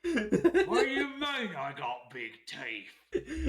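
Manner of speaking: put-on slow, high, loud, laughing try at an Australian accent; mock Australian portrait lady. Co-host voices the portrait woman answering the teeth joke, in a laughing mock Australian accent. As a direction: voice the offended Australian sitter, badly, in an Australian accent. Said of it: "I can't really do a good Australian accent".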